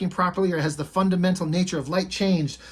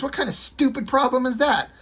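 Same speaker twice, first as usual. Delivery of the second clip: put-on scoffing kid doctor; 9 semitones higher than usual.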